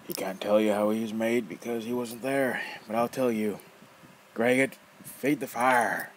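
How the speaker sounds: loud, rough, mocking old man; teasing old rogue. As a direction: toy with the kid, loud and gleeful. Nefer voice.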